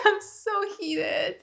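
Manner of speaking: drawn-out giggly I'm so heated; laughing repeat. Real, not acted. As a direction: laugh at your own outburst.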